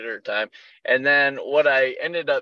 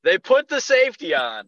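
raised-pitch exasperated reveal; indignant, higher than usual; exasperated disbelief; 9 semitones higher than usual. Retold or imitated. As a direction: announce the outrage, not yet shrieking. Exasperated repeat of the story's reveal.

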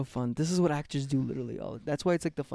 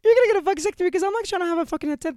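nagging wife; 7 dB louder than usual. Acted. Plays nagging wife in improv.

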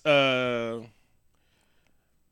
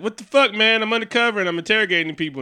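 mock annoyed outburst; 7 semitones higher, 6 dB louder than usual. Voicing Natasha annoyed on the phone.

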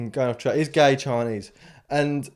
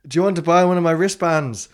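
put-on second go at a louder, higher Ghanaian accent; 4 semitones higher, 6 dB louder than usual. Ghanaian seller offering a tourist wristbands, put-on accent. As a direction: imitate a Ghanaian hawker's sales patter. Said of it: "It's a good accent".

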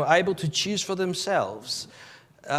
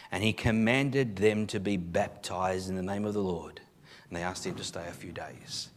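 ordinary talk

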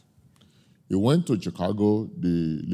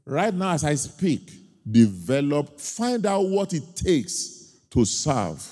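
slow, raised, urgent plea to the congregation; preaching exhortation; 5 semitones higher, slower than usual. Acted. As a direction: exhort them from the pulpit.

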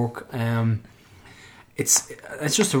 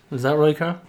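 ordinary delivery

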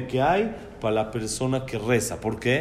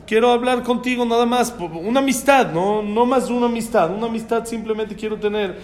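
higher-pitched, earnest persuasion; pleading suitor; 6 semitones higher than usual. Acted. Young man asking the princess for friendship, pleading. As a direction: coax her, insisting it is harmless.

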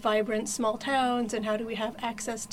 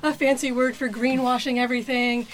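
imitating a fed-up younger self, louder and faster; mock-indignant rant, faster; angry college self; 2 semitones higher, 6 dB louder than usual. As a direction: scoff at the buzzword like an outraged teen. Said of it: "I was in my angry at everything because the world is messed up phase".

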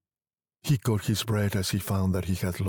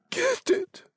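very high, urgent command; breathing quickly. A narrator's reading. Wife urging her husband, agitated and breathless. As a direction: urge him, high and agitated. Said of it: "breathing quickly".

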